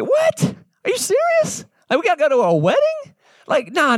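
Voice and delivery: very high, incredulous squeal; shocked squeak. Retold or imitated. Disciples can't believe Jesus picks a wedding, high shocked squeal. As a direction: shriek out the disbelief.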